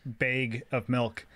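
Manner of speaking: "bag" said "baig", with a long "ay". Shown as a demonstration. Showing friends the one exception, bag pronounced baig. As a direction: slowly give "bag" a vowel like vague. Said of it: "I say bag unless it's".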